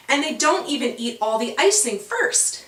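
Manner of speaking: plain reading voice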